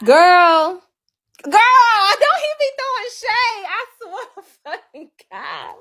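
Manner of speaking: high, loud cry that fades off; exasperated outburst. Real, not acted. Venting to girlfriends about his shade, high exasperated outburst. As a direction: burst out high, then let it drop away.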